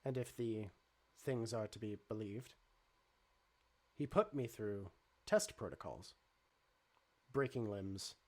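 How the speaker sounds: low, even, measured robot; calm robot voice. Acted. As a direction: speak like a calm android.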